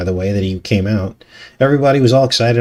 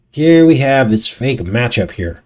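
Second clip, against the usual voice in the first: normal talking voice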